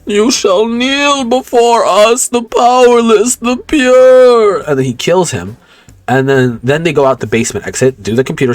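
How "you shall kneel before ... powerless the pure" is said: much higher, slow, booming monster proclamation; the Beast. Retold or imitated. The Beast declares to Pierce, higher slow booming proclamation. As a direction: proclaim slowly like a grand inhuman beast. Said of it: "the Beast is like".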